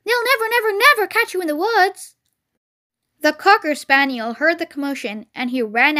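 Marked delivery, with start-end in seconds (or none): 0.0-2.2 high, loud cheering cry; shouting goose. Acted. Goose to fleeing Wilbur, high encouraging shout. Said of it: "she shouted to Wilbur".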